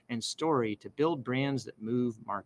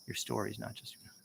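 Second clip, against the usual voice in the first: quiet run-through; 2 semitones lower, 12 dB quieter than usual. Real, not acted. Muttered rehearsal of the line.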